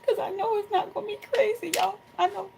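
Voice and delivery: high-pitched, choked up with happy emotion; real emotion lifting the voice high; emotional. Real, not acted. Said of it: "I'm sorry I got emotional".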